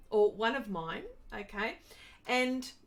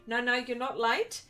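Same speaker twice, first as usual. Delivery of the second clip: ordinary talk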